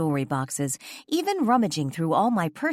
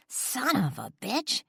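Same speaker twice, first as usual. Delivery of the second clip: snapping; 10 semitones higher than usual. A narrator's reading. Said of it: "she snapped".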